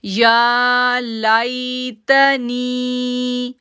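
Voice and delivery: "या लाइतनी" very slow, stretching "नी"; long drawn nee. Shown as a demonstration. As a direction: hold the "नी" for two counts. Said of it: "नी को क्यों खींचा". ("नी" को लंबा खींचकर)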